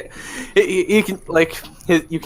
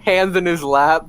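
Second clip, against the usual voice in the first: ordinary talk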